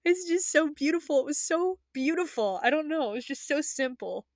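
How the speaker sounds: high, emotional gushing; passionate, choked up. Real, not acted. Fan explaining why the ending moved them, passionately overwhelmed. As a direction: gush helplessly, overwhelmed by the beauty. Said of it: "I'm really passionate about this".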